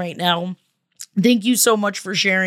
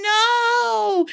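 a sudden high, wailing no; dismayed cry of no; 13 semitones higher than usual. Real, not acted. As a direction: let the no break with real dismay. Host reacts to sad letter.

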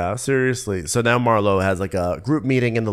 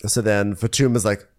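ordinary talk, nothing marked

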